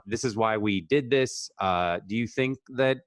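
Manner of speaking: normal talking voice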